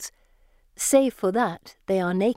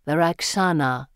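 low creature voice; low, weighty ancient voice; Gorgon voice; 3 semitones lower than usual. A narrator's reading. The Gorgon adds after pause.

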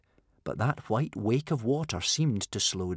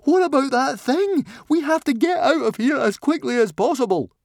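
high, loud, alarmed yell; panicked protest; 6 dB louder than usual. A narrator's reading. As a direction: yell urgently to flee. Fishlegs interrupts in panic.

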